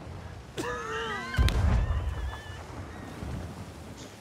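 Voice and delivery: long breathless fit of laughter; helpless laugh that runs out of air. Real, not acted. Laughing at crew's chaos.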